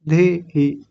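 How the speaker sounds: धे and ही slow, pulled apart; separated syllables. Shown as a demonstration. Teacher showing how to break a word, slow separated धे ही. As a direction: break धे ही in two, drawing out each part. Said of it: "अलग अलग कर लें।".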